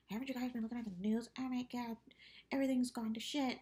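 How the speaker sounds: high, fast panicked voice; mock panic. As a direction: rush it like a panicked dad. Retelling the panicked dad.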